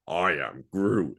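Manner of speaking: slow, drawn-out tree-creature voice; lightly voiced Groot, slow and heavy. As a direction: stretch it out like a lumbering tree. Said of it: "And then we just hear".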